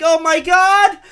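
high, hammed-up mom voice. Mocking the media as his mom, high pitched mom voice. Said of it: "The media is like my mom".

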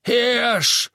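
high, loud one-word roar; thundering. A narrator's reading. Innkeeper scoffing at the Death Eater, thundering. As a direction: thunder it back scornfully. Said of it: "donnerte der Wirt".

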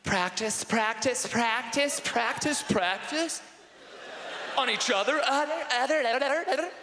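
high, rising repeated words; hammed-up girlish insinuation; coy girl. Imagined girl suggests kissing practice.

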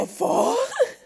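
high, incredulous squeak; shocked reaction. Retold or imitated. Retelling her first reaction, high and incredulous. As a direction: sound utterly baffled. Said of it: "I was like".

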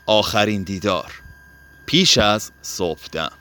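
plain reading voice

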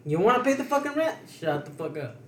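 mock challenge that drops into a curt shutdown; imitating fed-up retort. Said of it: "Fucking Juan got tired".

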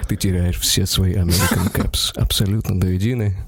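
low, grave lawyer verdict. Retold or imitated. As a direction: announce the loss like a stern lawyer. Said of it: "они вот так вот".

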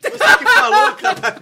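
high, loud laughing protest. Real, not acted. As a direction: laugh through the accusation. Laughing at the kiss joke.

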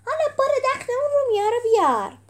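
high, loud battle send-off; rallying cry. A narrator's reading. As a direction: shout them into the fight. Rallying call before the fight.

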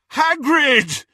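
high, loud cry of a name; bellowing. A narrator's reading. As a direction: bellow it out in astonishment. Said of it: "Harry bellowed".

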